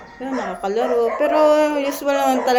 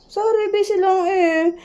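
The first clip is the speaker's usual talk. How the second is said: higher whimpering pet talk, mock-sorry; 8 semitones higher, 4 dB louder than usual. Real, not acted. Apologising to cat in baby talk.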